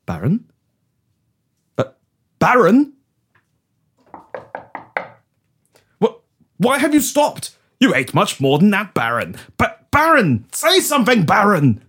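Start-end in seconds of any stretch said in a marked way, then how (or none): 2.4-11.8 rising, higher-pitched worried calls; acted alarm, pitch jumping up; alarmed calling. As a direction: call out with growing alarm to someone not answering. Calls to unresponsive Baron, alarmed.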